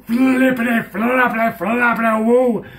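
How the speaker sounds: loud, high, giddy squeal of joy; over-the-top excited cheer; excited shout. Retold or imitated. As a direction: yell it like you can't contain yourself. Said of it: "I really got excited".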